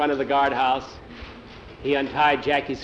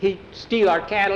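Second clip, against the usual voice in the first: higher-pitched, acted grievance; aggrieved accusation; 6 semitones higher than usual. A narrator's reading. Captive boy about the bandit Jose, aggrieved accusation. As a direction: accuse with feeling, pitch raised.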